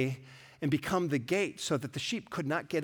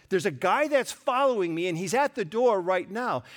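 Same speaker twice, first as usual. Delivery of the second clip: mildly frightened, higher and louder; scared sister; 5 semitones higher, 6 dB louder than usual. Retold or imitated. Sister waking her sleeping brother, mildly scared voice. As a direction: imitate her urgent scared warning. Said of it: "with her scared voice".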